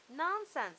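loud brisk dismissal; firm mother. Acted. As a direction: brush it off like a firm mom. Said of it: "said her mother".

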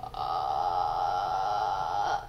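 deep creaky fry at the bottom; vocal fry. Shown as a demonstration. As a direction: let the voice crackle into fry. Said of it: "when your voice does this".

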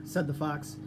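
ordinary delivery

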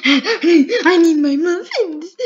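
loud, drawn-out whiny plea; mock wail. Player begging for muffins, mock crying. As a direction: wail it slowly through a laugh.

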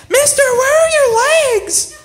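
put-on high, loud, drawn-out child's shout; loud kid. Kid accosting a stranger about his legs, loud and high. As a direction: blurt it out like a brazen little kid. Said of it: "kids don't care. They come up".

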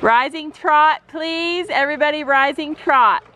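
brisk projected riding commands. Pretend. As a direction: call it across the arena. Mom calls riding commands.

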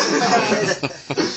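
big open laugh; loud laugh. Real, not acted. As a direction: burst out laughing. Laughs at own story.

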